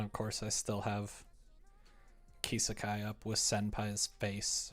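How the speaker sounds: ordinary delivery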